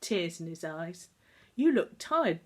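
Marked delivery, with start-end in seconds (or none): 1.6-2.4 concerned. Acted. Doctor's wife greets Tom.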